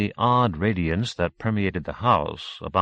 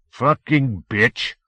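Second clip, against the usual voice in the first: slow bitter muttered curse; drawn-out curse; 5 semitones higher, 5 dB louder, much slower than usual. Acted. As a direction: spit it out slowly. Mel reels away cursing.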